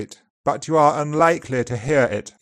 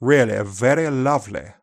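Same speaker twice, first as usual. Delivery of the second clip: upper RP accent. Shown as a demonstration. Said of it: "whereas in upper R P".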